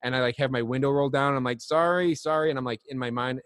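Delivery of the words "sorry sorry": higher, louder apology; quick raised-pitch apology to annoyed bystanders; apologizing aloud. Retold or imitated. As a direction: call sorry out of the car window. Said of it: "in my lower voice".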